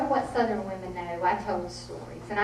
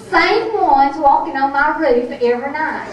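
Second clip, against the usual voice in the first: loud, higher-pitched imitation of a riled grandmother; indignant grandmother; 6 semitones higher, 12 dB louder than usual. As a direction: holler it out as the exasperated mountain woman. Storyteller acts out fed-up grandmother.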